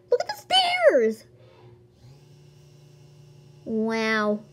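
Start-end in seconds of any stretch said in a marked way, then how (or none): 0.1-1.2 very high-pitched excited squeal; amazed squeal. Real, not acted. Player amazed at the stairs, high squeal.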